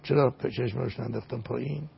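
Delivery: normal talking voice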